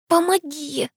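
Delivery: high, strained plea for help; effortful groaned plea; grunting with effort. A narrator's reading. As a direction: squeeze it out while hauling yourself up. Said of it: "прокряхтела я".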